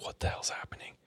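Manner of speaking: hushed, scared whisper; breathless whispered panic; freaked-out whisper. Retold or imitated. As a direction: whisper it like you don't want to be heard. Freaked-out tech's inner thought.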